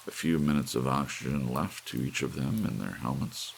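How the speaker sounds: ordinary delivery, nothing marked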